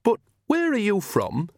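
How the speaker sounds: put-on high-pitched, harmless old-lady voice; put-on old biddy, innocently curious; soft old biddy. A narrator's reading. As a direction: ask sweetly, like a nosy old dear. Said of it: "in her soft old biddy voice".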